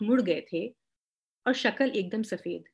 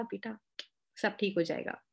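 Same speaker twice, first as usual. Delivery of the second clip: reassuring; 8 dB quieter than usual. Retold or imitated. Aunty comforting narrator.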